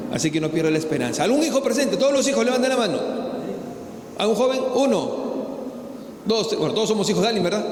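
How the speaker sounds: loud, driving sermon to the congregation; full-force preaching. Acted.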